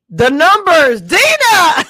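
loud, high call to a friend. Real, not acted. Streamer calling out a viewer named Dina, loud teasing shout. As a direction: shout her name across the room.

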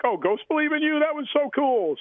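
high, gushing mock fan voice. Fans gushing on Facebook.

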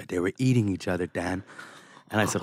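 ordinary talk

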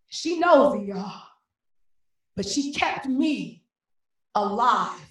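emphatic, rhythmic poetry with lifted refrains; spoken-word poem. Acted. Poet honours mama who kept them alive, spoken word. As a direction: build through the repeated lines, then slow and settle at the close.

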